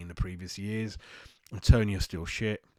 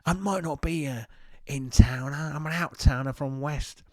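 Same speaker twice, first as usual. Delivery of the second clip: exaggerated Cockney; 6 semitones higher than usual. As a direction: ham up a London Cockney accent in protest. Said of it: "my Cockney ass-, accent isn't good".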